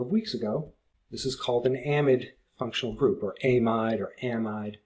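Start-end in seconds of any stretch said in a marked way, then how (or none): 1.9-2.3 amide said AM-id, short second vowel; variant pronunciation. Shown as a demonstration. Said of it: "however you want to say it". 3.4-4.0 amide said AY-mide, long A first; variant pronunciation. Shown as a demonstration. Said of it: "however you want to say it". 4.2-4.8 amide said AM-ide, short A then long I; variant pronunciation. Shown as a demonstration. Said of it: "however you want to say it".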